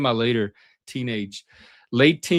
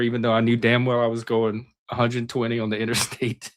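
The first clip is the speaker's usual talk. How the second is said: normal talking voice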